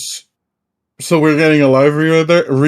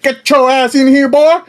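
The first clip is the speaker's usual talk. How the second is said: higher, louder mock summons; bossy mock call; 7 semitones higher, 6 dB louder than usual. Friend mimics the host calling him in, bossy. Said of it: "the guy was like".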